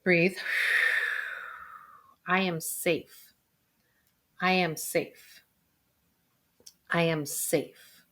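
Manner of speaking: slow, soft meditation voice; breathy exhale, then calm slow affirmation; guided meditation. Shown as a demonstration. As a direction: guide them into calm, slowly. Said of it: "keep those eyes closed. Breathe".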